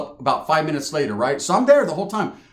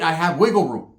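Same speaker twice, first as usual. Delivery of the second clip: normal talking voice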